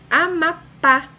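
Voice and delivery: Amapá, loud and high, syllable by syllable, strong final pá; stress on last syllable. Shown as a demonstration. Teacher giving an oxytone example, Amapá loudly stressed on pá. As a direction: punch the pá in Amapá. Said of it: "a sílaba mais forte".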